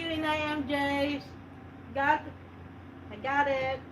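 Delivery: ordinary delivery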